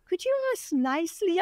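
high-pitched imagined plea; pleading for niceness. Retold or imitated. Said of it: "I'm thinking in my head".

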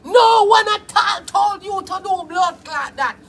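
ordinary talk

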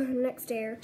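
ordinary talk, nothing marked

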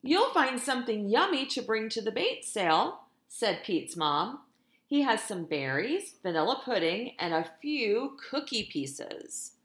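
lively, sing-song storytime reading; animated picture-book narration; reading to children. A narrator's reading. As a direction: read it like a teacher to her class. Teacher reads picture book aloud.

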